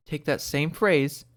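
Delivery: ordinary talk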